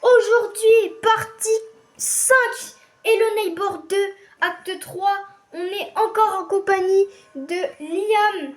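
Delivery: high, loud video intro; youtuber intro. Acted. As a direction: hype up the channel intro.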